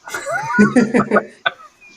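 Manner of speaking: a big shouted laugh; loud laugh. Real, not acted. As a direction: burst out laughing loudly. Players laughing at the yell.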